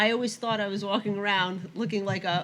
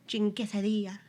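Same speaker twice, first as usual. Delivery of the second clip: Spanish accent; 2 semitones higher than usual. Shown as a demonstration. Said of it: "not saying guacamole".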